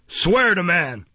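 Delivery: loud, grudging order; growled, ill grace. A narrator's reading. Magistrate grudgingly letting the witness testify, growling. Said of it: "growled Mister Fang with a very ill grace".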